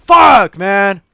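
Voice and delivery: high, loud raging yell; angry shout. Real, not acted. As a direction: blow up at losing the fight. Dying in the game, raging.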